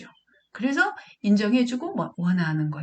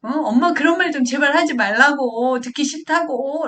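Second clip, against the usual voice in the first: put-on high, louder fed-up child; child's protest; 6 semitones higher, 6 dB louder than usual. Child begging mom to stop nagging, exasperated protest. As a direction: imitate a kid pleading crossly with mom. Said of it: "이런 식으로 아이가 자기 마음을 말한단".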